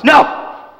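high, loud cry of protest; sharp cry. Acted. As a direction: yelp the word out. Renfield objects to removing spiders.

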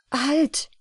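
sudden high exclamation; calling out. A narrator's reading. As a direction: call out to stop them. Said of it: "rief er".

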